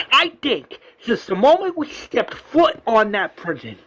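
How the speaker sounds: high-pitched, ranting governor; heated. Retold or imitated. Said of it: "gets out of the car heated".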